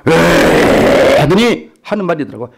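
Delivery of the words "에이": drawn-out, slow demonic grunt in a man's voice; demon's man voice. Retold or imitated. Possessed girl snarling at the pastor in a demon voice. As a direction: growl it out like a demon. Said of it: "남자 목소리로 바뀌는 거야".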